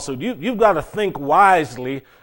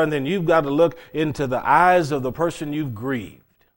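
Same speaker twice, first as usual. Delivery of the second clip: ordinary talk, nothing marked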